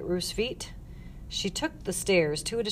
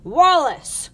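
high, loud summons; shout; 9 semitones higher, 10 dB louder than usual. A narrator's reading. Matron summoning her staff, shouted. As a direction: yell for help down the hall. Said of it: "shouted Miss Loud".

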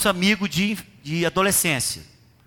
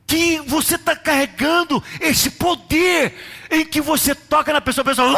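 full-force preaching; 6 semitones higher than usual. Acted. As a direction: build it up like a revival preacher.

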